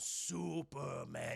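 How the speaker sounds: slow, low robot drawl; giant robot. Retold or imitated. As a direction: draw it out low like a huge machine. Giant naming itself, retold.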